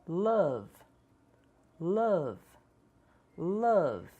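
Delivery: love with a short uh vowel; English vowel. Shown as a demonstration. Teacher models love's vowel for learners. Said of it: "Tente repetir as palavras".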